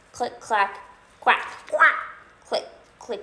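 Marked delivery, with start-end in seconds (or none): 1.2-1.9 high, loud put-on quack; duck quacks. Ducks typing their note, high loud quacking.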